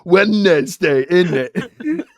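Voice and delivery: laughing through a put-on British accent. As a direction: drawl Wednesday like a cartoon Brit. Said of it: "any sort of British accent".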